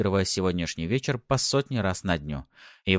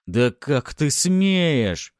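loud, very slow, raised voice; outraged; 8 semitones higher, 6 dB louder, much slower than usual. A narrator's reading. Husband rebuking his defiant wife, outraged. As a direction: stretch each word in outrage.

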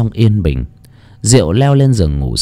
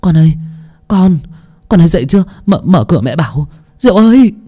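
high, urgent mother's call; panicked calling; 8 semitones higher, faster than usual. A narrator's reading. Mother frantically calling her daughter downstairs, panicked high call. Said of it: "Bà vội vàng chạy xuống tầng gọi Diệu".

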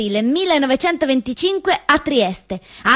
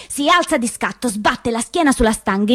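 ordinary talk, nothing marked

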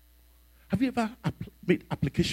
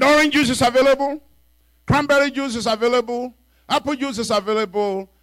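cheery host; 11 semitones higher, 6 dB louder than usual. Retold or imitated. Bishop's cheery host offer.

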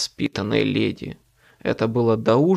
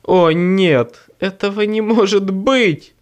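horrified; 7 semitones higher, 6 dB louder than usual. A narrator's reading. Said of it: "душа моя ушла в пятки".

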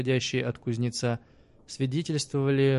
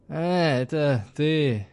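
slow slurred forced words; drunk slur; 4 semitones higher, 4 dB louder, much slower than usual. A narrator's reading. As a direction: squeeze it out, drunk. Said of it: "выдавил Станис".

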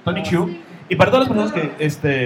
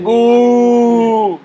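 sneering panto villain; 7 semitones higher, 8 dB louder than usual. Retold or imitated. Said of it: "Les dicen".

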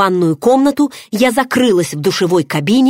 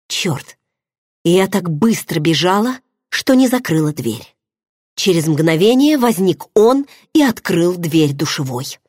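plain reading voice

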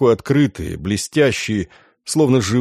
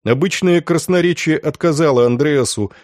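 plain reading voice

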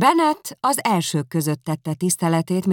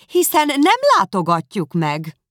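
sharp, reproachful mother's complaint; 5 semitones higher, 7 dB louder than usual. A narrator's reading. Mother reproaching husband over Bingley, reproachfully. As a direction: scold the husband through the daughter. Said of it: "Bennet szemrehányóan".

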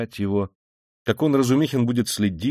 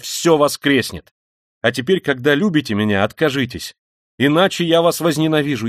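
impassioned renunciation; 5 semitones higher, 6 dB louder than usual. A narrator's reading. Emotional break with his family.

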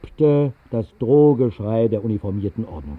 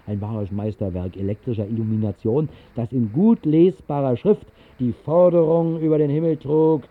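usual speaking voice

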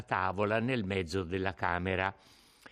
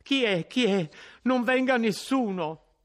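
high, loud frightened shout; terrified cry; 12 semitones higher, 6 dB louder than usual. A narrator's reading. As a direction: cry out in panic at the knocking. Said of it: "gridò".

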